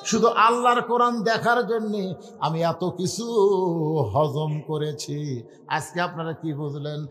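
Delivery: slow, drawn-out mock weeping chant; preacher's tearful retelling, wavering on long vowels; tearful lament. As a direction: stretch the words out as if crying. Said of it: "Omar Faruk কান্দে আর বলে".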